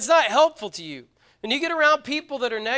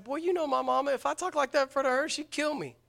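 higher-pitched, mildly incredulous retort; retold protest; 4 semitones higher, 5 dB quieter than usual. Young man telling friend about his mama, retold protest. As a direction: replay a quick protest, voice lifted a little.

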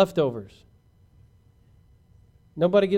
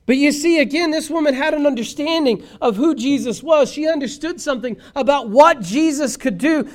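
high, loud, driving sermon delivery; full-force preaching; 9 semitones higher, 8 dB louder than usual. Real, not acted. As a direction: build the case in insistent, ringing repetitions.

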